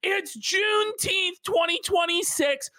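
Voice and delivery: high, hollering hype man; hype shouting. Acted. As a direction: whip the crowd up, pitched high. Hyping the co-host and day.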